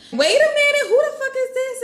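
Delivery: high, loud disbelieving cry; stunned reader yelling at the book; shocked outburst. Real, not acted. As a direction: jolt up in outrage at the twist. Reader shocked by plot twist.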